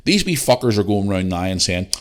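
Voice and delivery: usual speaking voice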